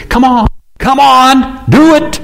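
high, loud, slow urging; urging coach. Retold or imitated. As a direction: push them like a coach. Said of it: "He says".

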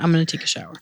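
quiet repeat of own shower. Shown as a demonstration. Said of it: "I definitely say it like that".

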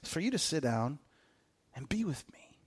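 hushed, near-whispered plea; soft Jesus. Retold or imitated. Jesus gently telling a busy believer to sit, softly. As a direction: say it softly and close. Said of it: "Jesus is like".

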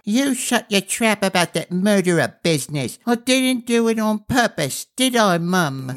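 put-on a small boy defending himself; indignant little boy. A narrator's reading. Little one to his brother and mum, high whiny protest.

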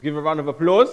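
loud, high host call; calling for applause. Real, not acted. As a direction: pump up the crowd.

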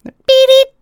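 high, loud piercing parrot beeps; loud squawking beep imitation; loud bird beeps. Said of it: "Birds are fucking loud".

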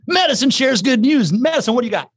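high, lively show-host announcement; segment announcer. Real, not acted. Host handing a segment to a co-host, high announcer delivery.

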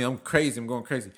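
ordinary talk

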